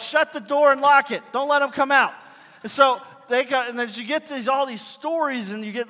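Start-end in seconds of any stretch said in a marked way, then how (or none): 0.0-2.1 mock panicked shout. Parents hear kids crying on porch.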